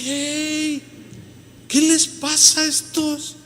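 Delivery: high, slow puzzled voice; mock bewildered onlooker. Preacher voicing a puzzled visitor, high and slow. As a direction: play a baffled visitor.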